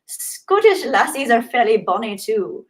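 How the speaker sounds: slow, badly faked Scottish accent. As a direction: have a clumsy go at Scottish. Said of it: "I can't do a Scottish accent".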